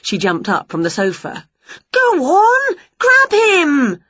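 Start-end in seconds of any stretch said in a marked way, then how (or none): 1.9-4.0 high, loud egging-on; excited cheering. Acted. Witch cheering the wrestler on from the sofa, excited. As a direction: urge the wrestler on.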